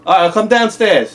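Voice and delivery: shouting back downstairs; loud call to another room. Real, not acted. Calling out to buddy.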